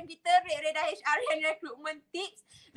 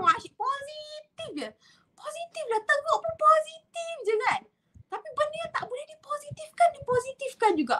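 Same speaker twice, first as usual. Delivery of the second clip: mock cheery positivity; 10 semitones higher than usual. Mocking overly positive people.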